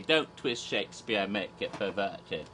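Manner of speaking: normal talking voice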